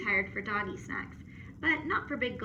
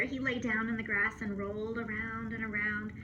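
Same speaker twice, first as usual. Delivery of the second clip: plain reading voice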